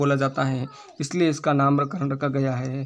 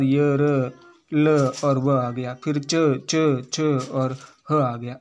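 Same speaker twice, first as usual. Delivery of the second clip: slow recap; much slower than usual. Shown as a demonstration. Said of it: "सब आगे देखो".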